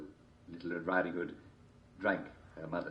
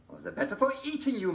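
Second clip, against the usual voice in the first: high wolf voice. A narrator's reading.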